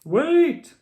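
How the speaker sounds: high loud call after someone. A narrator's reading. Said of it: "called Mister Brown".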